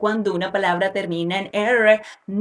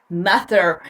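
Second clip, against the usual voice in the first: "mother" in an American accent, ending on a clear "-er" with the R; 4 semitones lower than usual. Shown as a demonstration. Teacher demonstrating an American "mother" to learners, R heard. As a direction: sound the R at the end of "mother", American style. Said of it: "hablar inglés con acento americano".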